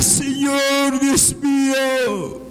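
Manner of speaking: usual speaking voice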